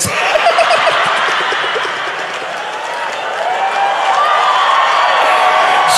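long, high-pitched laugh. Real, not acted. Preacher cracking up at his own joke, high long laughter.

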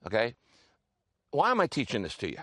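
usual speaking voice

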